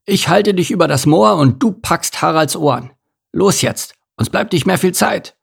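insistent bear directing a rescue; urgent command. A narrator's reading. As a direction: hurry them along, time is short.

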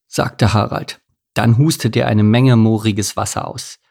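plain reading voice

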